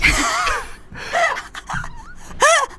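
laughing. Real, not acted. Laughs at his outburst.

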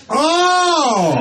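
high, loud fake dawning oh; mock realisation. Him finally getting the fog, oh. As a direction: act like it just clicked. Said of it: "I go".

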